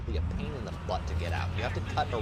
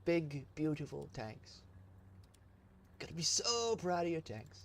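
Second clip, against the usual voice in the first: soft, slow, higher doting coo; soft doting coo, much higher and slower; 6 semitones higher, 7 dB quieter, slower than usual. Pretend. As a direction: croon over the tanks like babies. Cooing over tanks.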